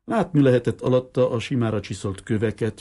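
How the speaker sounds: usual speaking voice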